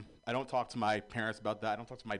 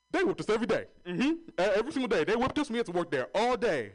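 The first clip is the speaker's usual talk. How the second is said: exaggerating elder; 9 semitones higher, 5 dB louder, faster than usual. Exaggerating grandfather tells hardship.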